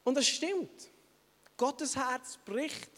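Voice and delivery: usual speaking voice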